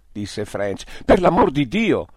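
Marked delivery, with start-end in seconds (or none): 1.0-2.1 higher, louder outcry; exclaiming. Acted. Peterson crying out against shooting the Wub, loud exclamation. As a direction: burst out in protest. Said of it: "esclamò Peterson".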